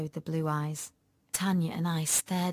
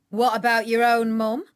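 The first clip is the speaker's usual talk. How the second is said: loud, raised stern question; firm police sergeant, voice up; 5 semitones higher, 8 dB louder than usual. A narrator's reading. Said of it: "said the sergeant sternly".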